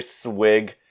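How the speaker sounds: ordinary talk, nothing marked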